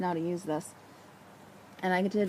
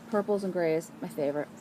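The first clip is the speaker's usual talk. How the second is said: normal talking voice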